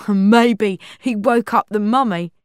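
loud, amused joke with a laugh; laugh, then crack the joke loudly; laughing wisecrack. A narrator's reading. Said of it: "Xander laughed".

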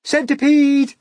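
a loud, high shout. Acted. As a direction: call him up with excitement. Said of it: "they shouted".

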